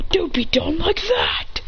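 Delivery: slow, high whispered taunt. Acted. Fighter taunting Hit mid-combo, slow whisper. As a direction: hiss the taunt under the breath.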